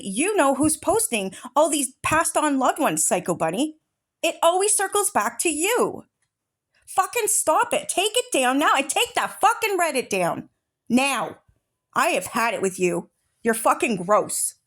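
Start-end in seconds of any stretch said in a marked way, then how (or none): 4.2-11.4 higher, angry demand to take it down; yelling at the culprit, done with it; furious rant. Real, not acted. Creator berating Psycho Bunny over posts, furious higher yell.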